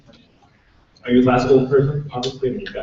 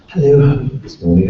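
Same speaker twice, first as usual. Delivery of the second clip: authoritative, louder posh British RP greeting; British received pronunciation; 2 semitones higher, 7 dB louder than usual. Acted. Voice actor greets hotel concierge, authoritative British RP. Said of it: "start doing this bashful voice".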